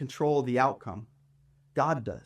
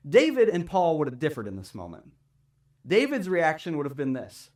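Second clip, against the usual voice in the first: ordinary talk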